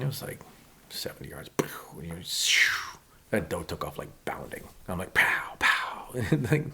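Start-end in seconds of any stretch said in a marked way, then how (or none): 2.4-3.0 airy whooshing rifle shot; voiceless mouth-made bullet sound; imitated gunshot. 5.1-6.1 two punchy mock rifle shots; airy, popping pow sounds; imitated gunshots.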